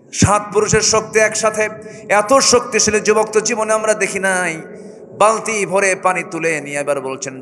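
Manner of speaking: high, loud sermon chant; full-force preaching. Acted. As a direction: preach it to the crowd.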